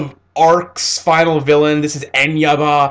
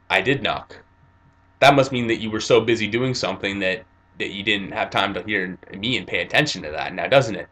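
lower, softer deadpan hero voice; cool Jotaro; 6 semitones lower, 7 dB quieter than usual. Retold or imitated. Calm hero calling out the lying old woman, low and cool. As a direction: say it low and knowing, unimpressed. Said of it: "And Jotaro's like".

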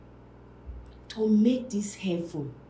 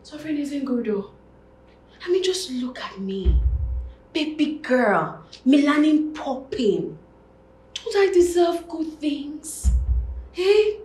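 high, loud, animated bragging; big, showy self-praise; self-admiring plea; 8 semitones higher, 8 dB louder than usual. Acted. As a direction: plead your own case with loud, high enthusiasm. Shows off, pleads own worth.

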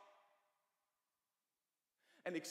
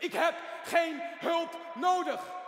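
much higher and louder, slowed down; angry shout; 9 semitones higher, 12 dB louder, much slower than usual. Acted. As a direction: hurl the refusal at him. Patient snaps at the therapist. (woedend geschreeuwd, hoog en langzaam)

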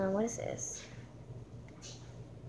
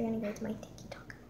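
whispered aside; 10 dB quieter, faster than usual. Real, not acted.

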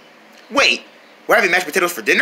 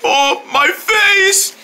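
slow pained groan; 11 semitones higher, much slower than usual. Acted. Hit in the face.

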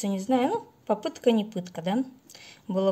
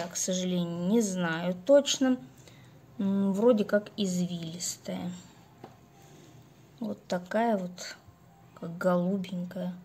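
ordinary talk, nothing marked

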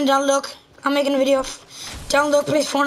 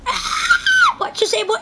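angry shout; slower than usual. Acted. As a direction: bark it out furiously. Yelling at the voiced duck.

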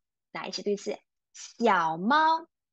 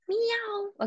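playful meow; 11 semitones higher than usual. Pretend. Teacher imitates cat after 猫.

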